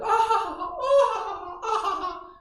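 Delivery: shrieking-high exaggerated laughter; mock laughter. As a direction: parody people laughing everywhere they go. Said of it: "walk around and like".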